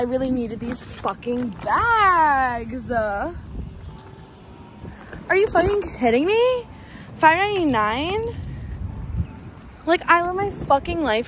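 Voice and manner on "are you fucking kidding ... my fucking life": thrilled about a five ninety-nine bag; excited. Real, not acted. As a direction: gush over the bargain. Thrilled at thrift bag price.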